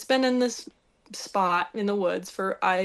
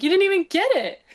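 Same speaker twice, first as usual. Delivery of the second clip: mock disbelief; 10 semitones higher, 6 dB louder, faster than usual. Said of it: "I'm like".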